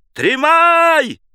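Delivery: very high, loud drawn-out cry; piercing high call; thin tenor shout. A narrator's reading. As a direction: yell it back in a thin tenor. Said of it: "кричал в ответ первому второй тонкий тенор".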